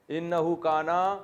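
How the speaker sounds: slow recited انہو کانا, drawn out; recitation. Shown as a demonstration. Teacher slowly recites the verse ending for students. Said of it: "closing recitation of verse".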